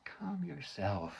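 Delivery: slow, hushed whisper. Acted. Mother to her crying daughter in public, whispered. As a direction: hiss it so no one hears.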